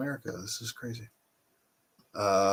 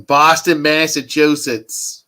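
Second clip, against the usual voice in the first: loud, higher-pitched bad Boston accent; terrible Boston accent; 4 semitones higher, 9 dB louder, slower than usual. Streamer saying where Chris Evans is from, terrible Boston accent. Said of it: "that's a terrible Boston accent".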